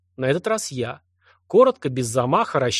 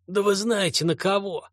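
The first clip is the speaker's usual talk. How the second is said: sleazy thug voice; 6 semitones higher than usual. Acted. Pimp threatens captors.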